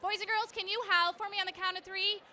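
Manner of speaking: put-on kids show host. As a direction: pump up the kids for the count. Leading kids in a howl.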